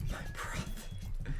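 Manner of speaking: breathy whispered line. Acted. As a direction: whisper it dramatically.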